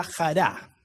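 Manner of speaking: "jará" stressed on the last A; stress on final A. Shown as a demonstration. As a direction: put the accent on the final syllable of "jará". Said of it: "con acento en la A".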